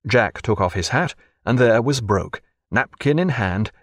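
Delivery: usual speaking voice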